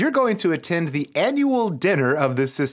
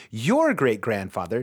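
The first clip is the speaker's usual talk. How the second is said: ordinary talk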